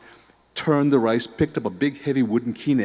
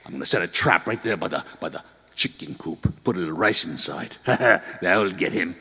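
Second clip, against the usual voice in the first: grandfather voice; 2 semitones lower than usual. Acted. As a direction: plot the trap with glee. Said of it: "He went to Oba-chan ... said".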